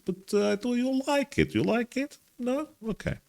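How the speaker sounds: put-on very high playful Russian accent; slight Russian accent. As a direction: coax an answer in mock Russian. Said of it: "interesting slight Russian accent".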